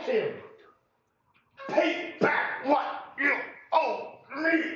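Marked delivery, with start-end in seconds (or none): 1.7-4.6 a touch of stern demand, higher and slow; mock demand. Servant demands his debt back from his fellow, mildly stern. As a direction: drag out the demand with a hint of menace. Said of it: "he demanded".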